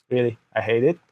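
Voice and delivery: usual speaking voice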